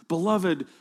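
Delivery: ordinary talk, nothing marked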